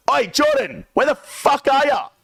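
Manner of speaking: high loud rowdy call across the room. Retold or imitated. Perp interrupting the champagne toast, high rowdy yell. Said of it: "Perp just walks into the room and goes".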